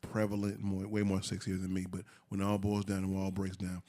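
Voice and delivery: low, soft seductive voice; sexy voice. Pretend. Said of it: "my sexy voice is right here".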